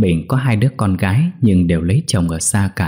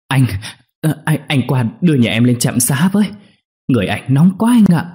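stammering, pitched-up plea; breathless urgency; 6 semitones higher than usual. A narrator's reading. Wife begging the neighbour for help, breathless and urgent. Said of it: "thở không ra hơi nói gấp".

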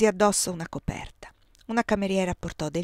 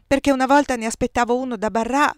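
louder, higher, agitated; distressed outburst; 6 semitones higher, 9 dB louder than usual. A narrator's reading. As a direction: push it out in distress.

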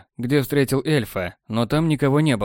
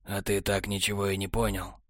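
lower, softer, weary sigh; sighing technician; 4 semitones lower, 9 dB quieter than usual. A narrator's reading. Technar sighing at Borland, low soft and weary. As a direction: sigh it out, disappointed. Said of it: "вздохнул технарь".

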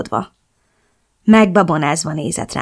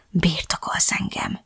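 slow, very soft whisper; 9 dB quieter, slower than usual. A narrator's reading. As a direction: drop to a slow, intimate whisper. (lassú, halk suttogás)

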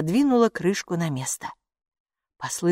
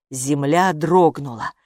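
plain reading voice

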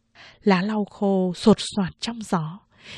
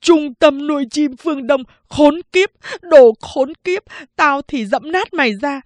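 loud, higher shouted curses while stamping; stamping and swearing in rage; furious cursing; 5 semitones higher, 6 dB louder than usual. Acted. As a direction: yell the curses, loudest on khốn kiếp. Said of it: "vừa dẫm vừa chửi".

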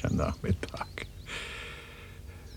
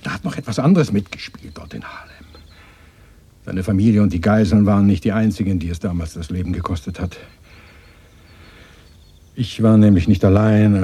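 louder, pressing confession; emphatic confession; 2 semitones higher, 8 dB louder than usual. Acted. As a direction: force the truth out. Emphatic confession under emotion. (lautes, eindringliches Geständnis)

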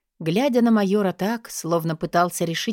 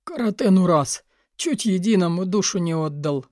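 gruff major getting his breath back; catching breath. A narrator's reading. Major after choking on an olive, catching his breath. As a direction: exclaim after nearly choking. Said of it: "С трудом отдышавшись, сказал Фордс".

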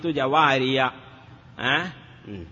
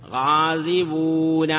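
slow, stretched "غازبون" with long vowels; drawn-out citation; slower than usual. Shown as a demonstration. Slow citation of underlying form.

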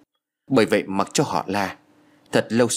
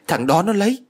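high, loud accusing outburst; 10 semitones higher, 4 dB louder than usual. Acted. Rich woman blaming the young man for her gold, high loud outburst. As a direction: fling the blame in a high burst.

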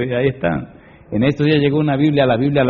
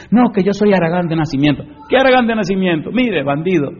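raised, louder mock excuse, then a quick higher retort; acted scolding exchange; 7 semitones higher, 4 dB louder than usual. Preacher answers a lazy man's excuse, mock scolding. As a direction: throw the excuse back in mock outrage.

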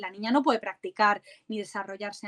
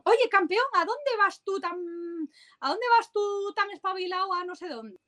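imitating a stranger; 8 semitones higher than usual. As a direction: play a grown-up teasing a little lad. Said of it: "Y entonces le dicen". (voz aguda de adulto que bromea con un niño)

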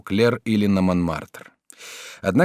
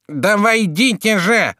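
high, loud, drawn-out nasal call; impatient nasal shout; 10 semitones higher, 5 dB louder, slower than usual. A narrator's reading. Judge summons visitors third time, impatient nasal shout. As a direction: insist loudly through the nose. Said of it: "сказал в третий раз тот же голос".

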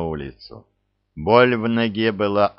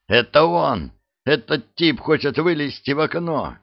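urgent shout; 7 semitones higher than usual. A narrator's reading. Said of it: "крикнул раненый".

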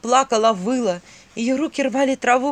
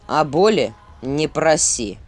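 stern prince voice; 6 semitones lower, slower than usual. Acted.

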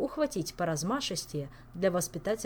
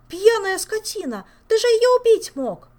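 furious scream; 14 dB louder than usual. Acted. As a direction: scream at him in outrage. Olga screaming at Elmar.

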